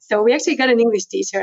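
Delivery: ordinary delivery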